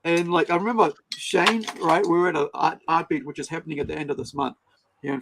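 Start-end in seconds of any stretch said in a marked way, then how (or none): none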